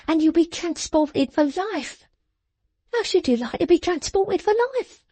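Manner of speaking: high, sharp scolding voice; scolding little woman. A narrator's reading. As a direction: scold him like a child. Jenny scolding her father.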